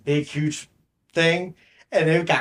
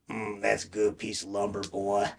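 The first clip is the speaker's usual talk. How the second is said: low, soft Sling Blade character voice; Southern movie-character impression, dropped low and quiet; Billy Bob Thornton; 5 semitones lower, 7 dB quieter than usual. Talking to himself at Home Depot, Sling Blade voice. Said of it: "I was talking like that".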